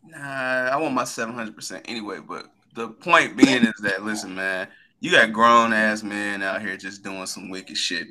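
usual speaking voice

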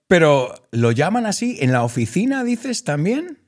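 normal talking voice

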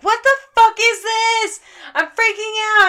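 high, loud panicked yell; freaking out. Retold or imitated. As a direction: reenact a total freak-out. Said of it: "I'm freaking out to Alex".

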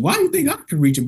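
fast, very high questioning; incredulous high, quick question; retold disbelief. Doubting coworker's request.

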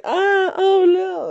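high, whimpering mock fright; hammed-up frightened wail; mock scared cry. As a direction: squeal like a scared little kid. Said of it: "they think you're gonna be like".

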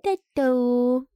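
lightly voiced tiny child; playful copy of toddler speech; toddler imitation. Parent copying son's thanks, light toddler voice. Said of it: "Finn this week has been saying thank you".

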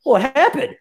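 high, shocked "What happened?"; imitating his own alarmed cry; a sharp retold jolt of alarm. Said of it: "I go".

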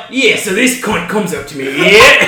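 imitating a friend's British stage delivery; teasing take on a British accent; mock-sophisticated British accent. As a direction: do a sophisticated British comic, swearing casually. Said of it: "you sound so sophisticated".

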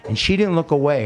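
ordinary talk

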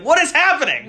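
high, loud, laughing what is happening; baffled disbelief. Retold or imitated. Retelling the pancake entrance to a friend, laughing incredulous cry. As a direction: blurt it out in amused disbelief.

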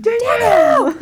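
high, loud playful yell. Retold or imitated. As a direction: yell her name across the cockpit. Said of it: "I just love yelling at Danielle".

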